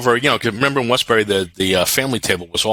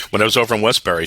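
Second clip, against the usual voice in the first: ordinary talk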